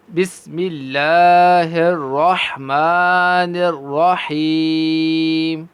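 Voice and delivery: very slow, drawn-out recitation; slow tajweed chant; Quranic recitation. Shown as a demonstration. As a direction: chant the basmala slowly. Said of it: "ان میں ہا کی آواز واضح کریں".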